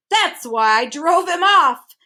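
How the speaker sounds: loud, slow, blustering; fierce cry. A narrator's reading. Humbug boasting about driving off the bird, fierce. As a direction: bluster like a proud brave bug. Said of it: "cried the humbug fiercely".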